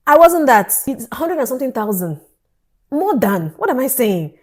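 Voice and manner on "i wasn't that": loud, high outburst; emphatic exclamation. Real, not acted. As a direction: burst out over the huge cost. Exclaiming over the huge fee.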